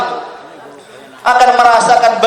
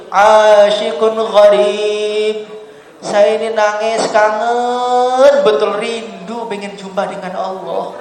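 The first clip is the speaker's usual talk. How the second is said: longing lover; much slower than usual. Retold or imitated. Weeping man tells Dhunnun he longs for Allah, slow yearning. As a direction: say it like a man aching to meet God. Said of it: "Dia mengatakan".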